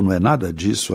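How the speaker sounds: usual speaking voice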